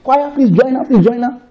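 high, laughing summons to the singers; excited call to choir. Acted. Carried-away host calling the choir to join, mock excited. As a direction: call the choir in, pitched well up, mock elated. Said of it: "I'll say".

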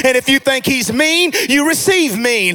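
driving, emphatic sermon delivery; punchy phrases building in fervor; full-force preaching. Real, not acted. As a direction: preach it to rouse the congregation.

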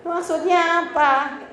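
scoffing at a neighbour's sad status, slowly; mocking disbelief. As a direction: ridicule the tearful post. Mocking tearful status posts.

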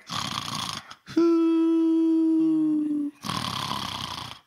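noisy breath, then a long high whistle; mock sleeping sounds. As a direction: play a sleeper's snore and whistle. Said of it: "Jesus is just like".

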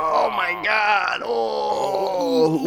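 slow high mock shout; mock scream of pain. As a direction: cry out slowly in hammed-up agony. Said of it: "He's like".

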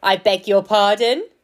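loud, growly stone lion; deep, growly voice. A narrator's reading. Percy posing as the stone lion, growly and loud. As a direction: growl it out indignantly. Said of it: "in a deep, growly voice".

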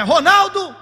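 loud, high call across a crowd; calling out a name. Retold or imitated. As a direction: shout the boy's name to make him come running. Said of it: "você grita assim".

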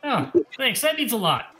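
high-pitched, faintly sarcastic thanks; tinge of sarcasm. Acted. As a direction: thank them with a hint of sarcasm. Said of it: "sarcasm on, on his voice".

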